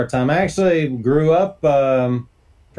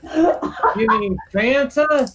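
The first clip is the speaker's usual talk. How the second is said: mock baffled teen; 10 semitones higher, much slower than usual. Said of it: "And you're like".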